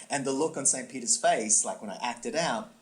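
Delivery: ordinary talk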